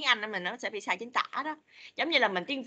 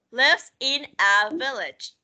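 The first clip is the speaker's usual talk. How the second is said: very slow, clear "Lives in a village"; slow model; 2 semitones higher, 5 dB louder, much slower than usual. Shown as a demonstration. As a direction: spell out each word slowly. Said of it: "À, lives". (đọc chậm rãi từng chữ)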